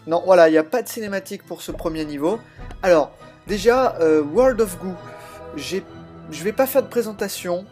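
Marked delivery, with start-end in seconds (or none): none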